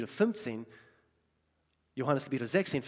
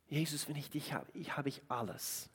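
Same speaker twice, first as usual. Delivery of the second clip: soft prayer; 10 dB quieter than usual. Real, not acted. Personal prayer-like confession.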